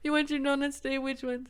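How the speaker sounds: hammed-up loud customer greeting; amused shop lady. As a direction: call it out big, imitating a tickled counter lady. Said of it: "just cracked up laughing. And she's like".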